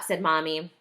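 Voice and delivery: ordinary delivery, nothing marked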